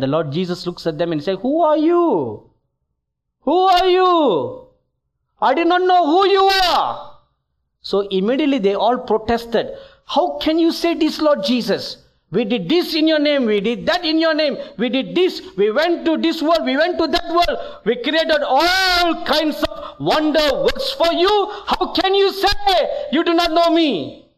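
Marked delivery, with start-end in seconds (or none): 1.4-7.2 angry rejection. Retold or imitated. Jesus turning away the false preachers, shouting. 10.1-24.3 high, pleading, rushed indignation; indignant protest. Retold or imitated. Preachers arguing their case before Jesus, high and indignant.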